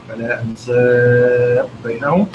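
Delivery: أنساب chanted with a medium-held vowel; slow recitation, medium stretch on أنساب; medium madd. Shown as a demonstration. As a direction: hold the aa of أنساب moderately long. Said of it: "توسط".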